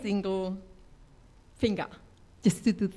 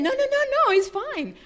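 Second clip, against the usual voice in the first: high, rushed no no no; flustered; 13 semitones higher, 4 dB louder than usual. Retold or imitated. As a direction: hurry to accept, flustered. Flustered reply to manager.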